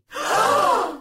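long, high dramatic gasp; shocked gasp. Acted. Shock at guards walking away.

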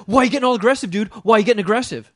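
high, loud accusing mimicry; retold yelling. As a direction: mimic a confrontational guy hollering. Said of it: "he's still, like, yelling shit at me".

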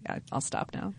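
whispered self-conscious aside; quiet. Real, not acted. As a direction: breathe it out sheepishly. Embarrassed trailing off.

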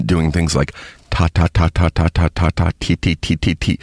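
crisp repeated "ta, ta, ta" then "ti, ti"; rhythmic drill of "ta" and "ti"; tongue exercise syllables. Shown as a demonstration. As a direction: tap the tongue off the roof of the mouth. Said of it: "I had to do a lot of exercises".

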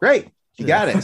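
loud, high mock enthusiasm; delighted approval. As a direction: imitate thrilled casting people handing over the part. Acting out delighted casting people.

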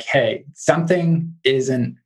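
normal talking voice

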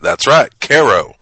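Cairo as KAY-ro, slow and a bit loud. Retold or imitated. Correcting people about his hometown, slow Cairo. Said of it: "I'm like".

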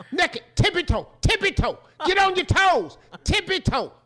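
high, punchy, hammed-up commands; acted bossy orders. Homeowner marching the naked intruder out, bossy. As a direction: drill him comically on every step. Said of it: "Now say it if it was you".

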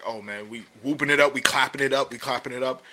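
mock the team getting hyped before kickoff. Players hyped before game day.

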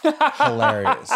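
stretched out slowly through real laughter; laughing. Real, not acted. Telling the balcony story, laughing high and slow.

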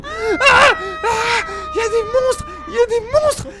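shrill high panicked cry; panicked screaming. Acted. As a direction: scream it in terror. Cheerleaders run back in panicked.

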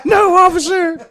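very high, slow, sing-song innocence; hammed-up innocent voice; mock innocent. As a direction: sweetly deny it all in a squeaky high voice. Mocking innocent reply to cops.

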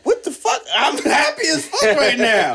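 high, surprised "what the fuck"; surprised outburst. Retold or imitated. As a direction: blurt it out, high and baffled. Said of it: "you be like".

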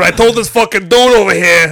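loud Boston tough-guy talk; hammed-up Boston loudmouth; Boston accent. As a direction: put on a brash Boston accent. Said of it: "should they be from fucking Boston".

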